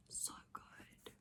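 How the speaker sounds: breathy whisper, barely there; whispered aside. Real, not acted. Whispering to viewers about a recommended book. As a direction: drop to a secret whisper.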